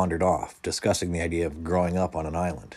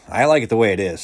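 mildly declarative, higher and louder; raised-voice character announcement; announcing; 4 semitones higher, 9 dB louder, faster than usual. A narrator's reading. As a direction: announce it firmly, as a narrated character. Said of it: "Stephen announced".

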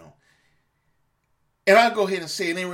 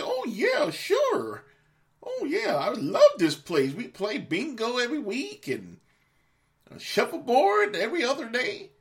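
much higher, squeaky mock delight; sarcastic fake cheer; 10 semitones higher than usual. Parent pretending to love the nursing home, shrill sarcastic cheer. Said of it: "You say".

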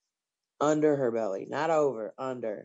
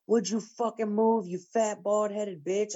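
imitated angry driver, raised pitch; mock yell, punching out each insult; higher-pitched mimicked road rage; retold angry scream; 6 semitones higher than usual. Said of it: "I can see him screaming".

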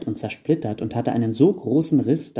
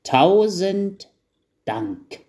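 put-on old elf; 9 semitones higher, 3 dB louder, much slower than usual. A narrator's reading. Old elf thanking with his new stick, slow and heartfelt. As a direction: stretch the first word like an old man. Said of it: "auch der alte Wichtel schlurfte".

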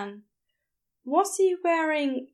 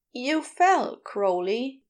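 concerned Bea; slower than usual. A narrator's reading. Bea on the phone to fallen Crowley, concerned.

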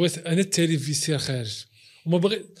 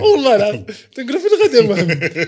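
laughing; 6 semitones higher, 9 dB louder, faster than usual. Retold or imitated. Retelling while laughing.

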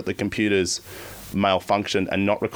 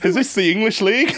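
mock confused caller; 11 semitones higher, 4 dB louder than usual. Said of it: "They would have gone".

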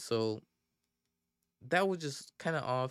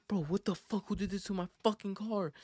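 higher-pitched mock outrage; imitated pissed-off outrage; 8 semitones higher than usual. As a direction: act out an incensed car owner, voice pitched up. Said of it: "He was pissed".

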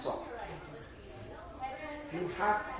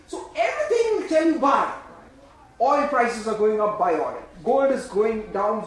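loud clamour; 7 dB louder than usual. Retold or imitated. Preacher mimicking everything that tells you to buy, loud clamour. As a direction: pile on the urgent advice loudly.

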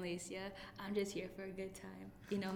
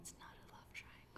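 whispered denial; 13 dB quieter than usual. Real, not acted. Denying the love triangle joke.

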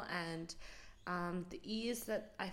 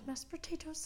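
high, playful echo; silly voice; 9 semitones higher, 4 dB quieter than usual. Pretend. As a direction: repeat it in a put-on high voice. Silly repeat of her dish.

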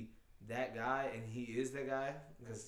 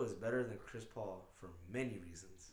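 lower, slower, softer smooth talk; boyfriend voice; 7 semitones lower, 9 dB quieter than usual. Pretend. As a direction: drop the voice low and sweet-talk slowly. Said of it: "Put your boyfriend voice on".

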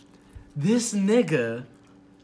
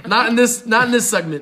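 louder, higher, laughing brush-off; 4 semitones higher, 7 dB louder than usual. Real, not acted. Putting off friends' tangent until later, laughing. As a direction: laugh while waving the topic away.

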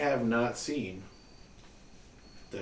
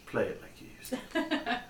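old-movie impression; 12 semitones higher, 7 dB quieter than usual. Casablanca quote in a put-on voice.

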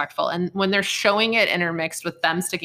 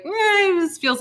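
high, louder hesitant "eh"; uneasy reaction; 13 semitones higher, 5 dB louder, slower than usual. Retold or imitated. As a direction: voice a reluctant, uneasy objection. Voices own uneasy past reaction.

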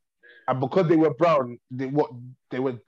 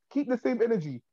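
ordinary delivery

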